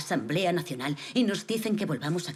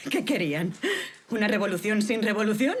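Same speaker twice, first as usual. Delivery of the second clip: scornful laughing question; 3 semitones higher, 4 dB louder than usual. Acted. Mocking the girondins' timidity.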